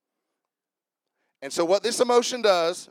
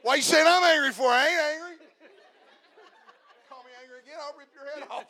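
higher, laughing put-on angry voice; mock angry guy; 9 semitones higher, faster than usual. Preacher voicing a volunteer playfully, mock angry and laughing. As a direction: ham up a touchy guy denying he's angry.

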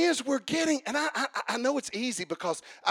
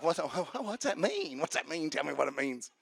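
mock confused listener; 7 semitones lower, 8 dB quieter than usual. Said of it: "You're like".

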